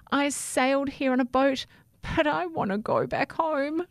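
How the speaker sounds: high-pitched voice of a lost little toothbrush; Sammy the pleading toothbrush. Acted. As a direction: explain your plight in a high voice.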